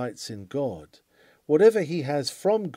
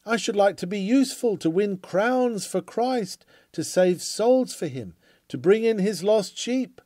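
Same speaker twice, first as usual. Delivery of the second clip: eager wish; 6 semitones higher, 4 dB louder than usual. Retold or imitated. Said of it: "I think I hear many of you say".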